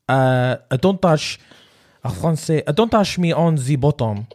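deliberate, comic French; French accent. Shown as a demonstration. Warming up with French words for the challenge, French accent. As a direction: work up to it in a thick French accent. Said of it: "That's French".

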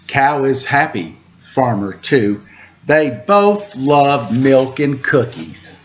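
sing-song rhyming picture-book read; reading to children. A narrator's reading. Host reads a farm picture book to kids, lilting. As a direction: lilt the rhymes for little listeners.

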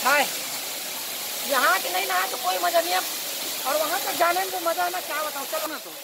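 delighted shouts over the splashing water; happy, high-pitched chatter from the pool; excited. Real, not acted. Excited while bathing.

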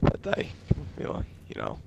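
low, strained voice with a sore throat. Real, not acted. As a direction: talk through a painful throat. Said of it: "Fucking hurts".

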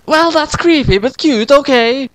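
put-on high girlish squeal of a reply; high-voiced heroine, put out but charmed. A narrator's reading. Heroine replies to Doctor Who, high-pitched.

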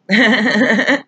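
high sarcastic fake laugh; mock laugh. Mimicking laughter at the drunk driver's joke, sarcastic. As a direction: fake a laugh at something not funny. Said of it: "We should just laugh 'cause this is all funny".

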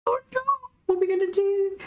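high, hammed-up panicked cry; mock panic. Retelling the garage door drama, high mock panic. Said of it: "So we had a little bit of".

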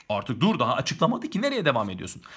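normal talking voice

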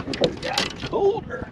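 high unvoiced whine; squeaky cold complaint; stamping feet for warmth. Repeats, high breathless whine.

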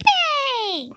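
high, loud delighted yay; excited cheer. Real, not acted. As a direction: cheer a win at full pitch. Winning a level.

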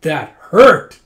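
loud acted outburst, hitting the last word hard; furious. Slapped drunk rages at the girl, furious. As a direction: play a drunk roaring in outrage. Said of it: "It makes him furious".

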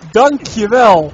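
loud, slow mock gratitude; sarcastic thanks. Real, not acted. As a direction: drag out a sarcastic thank you. Sarcastic thanks after teasing.

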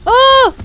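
short, loud high "oh" of alarm; startled yelp. Real, not acted. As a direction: yelp in sudden surprise. Startled by danger in game.

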